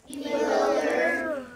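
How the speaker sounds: "Bewildered" said slowly, syllable by syllable; slow careful repeat. Shown as a demonstration. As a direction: echo the teacher's word carefully. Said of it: "Can you say bewildered".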